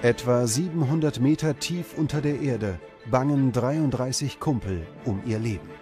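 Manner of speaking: usual speaking voice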